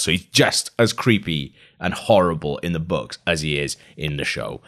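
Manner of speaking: usual speaking voice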